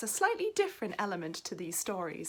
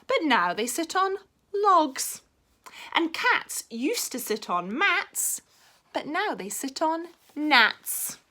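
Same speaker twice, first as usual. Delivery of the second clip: proud frog; 8 semitones higher, 6 dB louder, slower than usual. Acted. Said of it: "said the frog".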